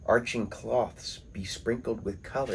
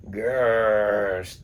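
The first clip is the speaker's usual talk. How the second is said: lion growl; 5 dB louder than usual. Acted. Narrator growls as the lion catches the monkey. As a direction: growl it out like a big cat.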